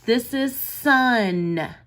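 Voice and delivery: "sun" said slowly, the "u" drawn out; slow word demo. Shown as a demonstration. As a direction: stretch out "This is sun" for children. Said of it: "We hear the uh sound in the middle of rub".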